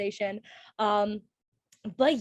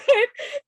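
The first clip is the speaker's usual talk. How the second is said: bright laugh; 11 semitones higher, 6 dB louder than usual. Real, not acted. Laughing after joke about Anna.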